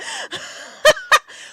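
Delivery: squealing high laugh. Real, not acted. Laughing at own story about the train in a high squeal. Laugh after retold quote.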